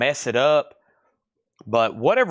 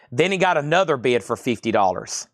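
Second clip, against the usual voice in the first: ordinary talk, nothing marked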